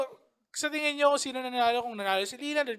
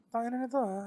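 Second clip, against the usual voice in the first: inner grumble; 6 dB quieter than usual. Retold or imitated. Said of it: "sa loob-loob mo, parang".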